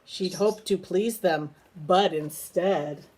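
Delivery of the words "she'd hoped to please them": plain reading voice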